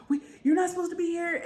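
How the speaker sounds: high-pitched mock alarm; frantic, high steward's objection; flustered protest. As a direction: protest in acted panic. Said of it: "he's having this heart attack".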